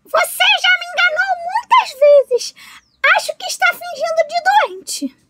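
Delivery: very high little fish voice, doubtful; a small fish who won't be fooled again; suspicious fish. A narrator's reading. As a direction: fish voice telling the alligator he's faking, high and wary. Fish refuses the alligator.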